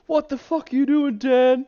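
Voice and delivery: high, loud mock outburst; imitated screaming. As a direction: yell it as an imitation of an angry caller. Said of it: "so he can start screaming".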